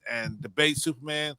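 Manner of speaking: ordinary talk, nothing marked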